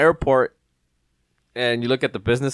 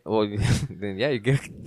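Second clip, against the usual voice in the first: usual speaking voice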